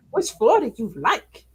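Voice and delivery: a hint of the snotty elevator; quick sassy echo; lightly voiced elevator. Imitating the elevator's attitude, mild sass. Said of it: "gave me attitude".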